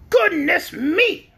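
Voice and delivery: high, slow hen exclamation; bewildered talking hen; puzzled hen. A narrator's reading. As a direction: draw it out high, baffled. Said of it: "returned the hen in a puzzled tone".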